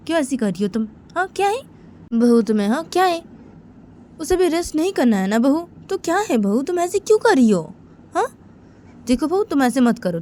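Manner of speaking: high-pitched, indignant questioning; acted family telling-off; exasperated scolding. Elder scolds daughter-in-law, acted quarrel.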